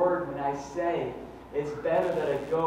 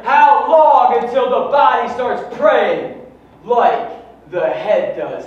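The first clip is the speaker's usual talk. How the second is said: high, loud, slow preaching cry; full-force preaching; 9 semitones higher, 15 dB louder, slower than usual. Acted. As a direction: preach it with everything.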